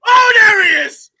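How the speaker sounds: loud, high, drawn-out cry of recognition; triumphant shout. Real, not acted. Panellist greeting the striker he waited for, triumphant shout. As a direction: shout it like the long-awaited name arrived. Awaited name finally announced.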